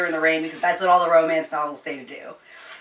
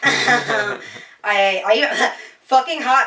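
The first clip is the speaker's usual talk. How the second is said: laughing; 6 semitones higher, 6 dB louder, much slower than usual. Real, not acted. Laughing repeat of her note.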